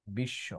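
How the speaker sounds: বিশ্ব as "bish-sho", no b after the sh; doubled sh. Shown as a demonstration. Teacher modelling the rule for pupils, বিশ্ব as "bish-sho". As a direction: say বিশ্ব low and soft with a long double sh. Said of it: "দুইটা তালিবাস্য হবে".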